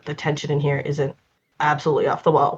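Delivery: usual speaking voice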